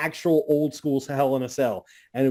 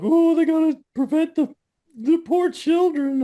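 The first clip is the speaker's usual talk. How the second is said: mock concerned prude; 13 semitones higher, 3 dB louder than usual.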